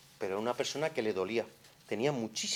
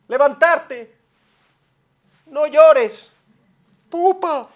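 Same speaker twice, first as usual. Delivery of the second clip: much higher, louder doting grandma voice; loud, high sing-song grandmother soothing; grandma comforting; 19 dB louder, much slower than usual. Retold or imitated. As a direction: coo over a crying toddler like a granny. Grandma comforting fallen child.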